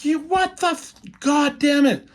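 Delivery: high, strained cry of disbelief and rage; anguished hero outburst. Retold or imitated. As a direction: shout at the loss, voice cracking up high. He-Man sees Sorceress dead.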